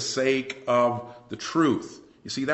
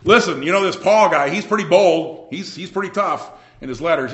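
lightly voiced detractor, louder and higher; scoffing false teachers; 6 semitones higher, 8 dB louder than usual. False teachers talking to the Corinthians about Paul, lightly scoffing. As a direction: raise the voice to run down a rival. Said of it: "they were saying to the Corinthians".

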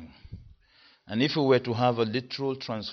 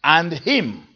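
"And him" lifted and louder; emphasis on "him"; stress on him; 3 semitones higher, 4 dB louder than usual. Shown as a demonstration. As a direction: hit "him" hard. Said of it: "in the third place it says".

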